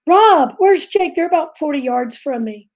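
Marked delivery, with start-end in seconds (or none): 0.1-1.1 higher, drawn-out call across the water; hollering. Retold or imitated. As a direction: call out to someone far off. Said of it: "I hollered".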